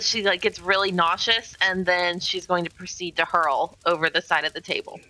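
ordinary talk, nothing marked

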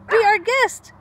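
high, loud nervous call; high nervous cry; the animals cry. Acted. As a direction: welcome the jaguar out of fear. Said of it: "the animals cry".

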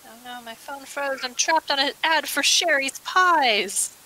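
ordinary talk

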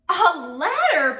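high, loud, slow amazed cry. A narrator's reading. Townsfolk crying out in amazement at the ladder. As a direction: gasp it out in wonder. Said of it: "people cried".

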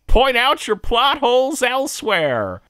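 very high, loud mock yell; hammed-up high-pitched holler to throw someone out; cartoon usher. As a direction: shout it in a squeaky cartoon usher voice. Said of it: "the usher's like".

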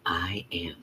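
soft unvoiced whisper; hushed whispered affirmation. Real, not acted. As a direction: breathe the affirmation. Meditation affirmation.

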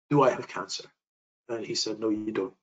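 ordinary talk, nothing marked

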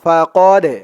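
slow, drawn-out chanted recitation; Quran recitation. Shown as a demonstration. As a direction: recite it slowly, stretching each vowel. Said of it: "recites the verse".

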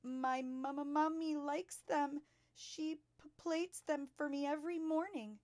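put-on high, stammering little-girl voice; frightened stutter. A narrator's reading. Terrified pupil answers the headmistress back, stuttering in fright. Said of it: "managed to stutter".

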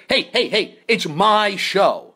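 high, loud hammed-up indignation; mock outrage. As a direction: rant about mutiny like a wronged captain. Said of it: "and I said".

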